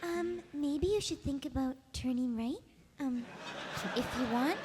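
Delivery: soft, timid cartoon GPS voice; Fluttershy. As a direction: give directions shyly and hesitantly. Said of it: "I like the Fluttershy one you did".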